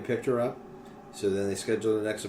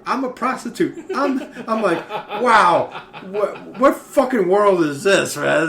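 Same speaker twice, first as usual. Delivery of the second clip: much higher, louder, laughing; laughing disbelief; 8 semitones higher, 9 dB louder, slower than usual. Retold or imitated. Cop reacting to the prostitute, laughing in disbelief. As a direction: cackle through the disbelief.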